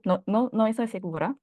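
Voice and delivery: ordinary talk, nothing marked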